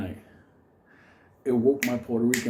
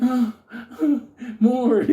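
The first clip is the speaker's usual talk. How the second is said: high, loud mock moans; dart victim begging; 11 semitones higher, 6 dB louder, much slower than usual. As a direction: moan in hammed-up pained pleasure. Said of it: "and he's like".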